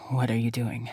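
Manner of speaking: low, rough male question. Acted. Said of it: "his voice rough".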